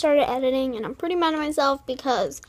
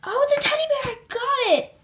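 surprised delight; 11 semitones higher than usual. Real, not acted. Guessing a present right.

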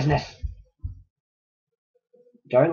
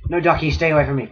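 ordinary delivery

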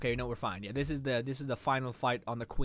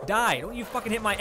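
louder barked kill command; loud yell; 6 semitones higher, 6 dB louder than usual. Real, not acted. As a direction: shout it at the enemy. Yelling at enemy.

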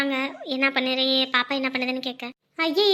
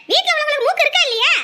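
put-on high, loud, nagging older woman; nagging mother-in-law; 10 semitones higher, 4 dB louder than usual. Mother-in-law scolding daughter-in-law over the burning stove, high and loud. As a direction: scold her about the burning pot.

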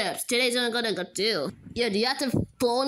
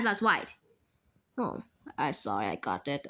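ordinary talk, nothing marked

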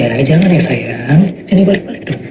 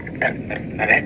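very soft, low murmur; barely voiced, asking for a moment; hushed aside; 12 semitones lower, 12 dB quieter than usual. Parent asks for a moment mid-call, hushed.

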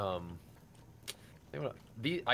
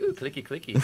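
playful mock voice; 6 semitones higher, 3 dB louder than usual. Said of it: "I was like".

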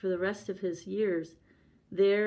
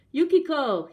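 loud, high call; 8 semitones higher, 9 dB louder than usual. A narrator's reading. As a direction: summon her from outside the screen. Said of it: "he called".

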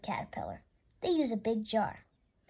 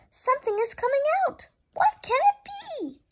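much higher, louder, in breathless wonder; excited wonder; 13 semitones higher, 4 dB louder than usual. Acted. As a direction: gasp it out as the pupa cracks. Caterpillar hatching, Pete watches.

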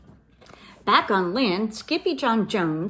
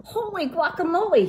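high, delighted burst of surprise; a gasp of wonder at something strange; surprised exclaim; 9 semitones higher, 3 dB louder than usual. A narrator's reading. Kitten spotting his reflection, high surprised exclaim. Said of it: "he exclaimed".

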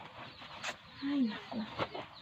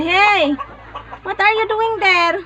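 calling out; 9 semitones higher, 9 dB louder, slower than usual. Real, not acted. Mother calling her child, loud call. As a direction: call across the yard.

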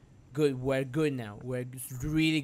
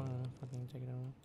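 barely voiced mutter; 3 semitones lower, 15 dB quieter, much faster than usual. Real, not acted. Repeat very soft fast.

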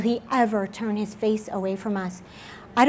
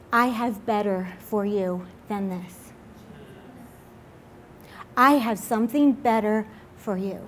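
kindly; 3 semitones lower, 5 dB quieter than usual. Retold or imitated. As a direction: speak it tenderly, as God reassuring. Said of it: "he's just kindly saying".

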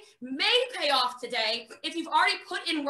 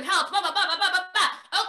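laughing blah blah; 3 semitones higher than usual. Real, not acted. Rattling list, laughing.

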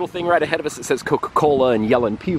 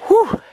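tired whew; 5 dB louder than usual. Real, not acted. Climbing the steep hill.

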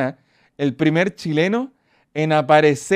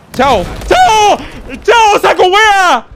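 much higher, louder, slow final taunt; stretched gloating yell; taunting shout; 10 dB louder, much slower than usual. Real, not acted. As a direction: yell goodbye at the enemy. Taunting an enemy flying off.

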